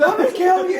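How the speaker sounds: high, loud mock yell. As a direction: scream the threat. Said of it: "I heard him yelling".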